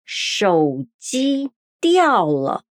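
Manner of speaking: syllable by syllable; 手机掉了 said slowly as shǒujī diào le; slow Mandarin demo. Shown as a demonstration. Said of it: "O celular caiu".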